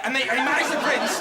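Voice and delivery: ordinary delivery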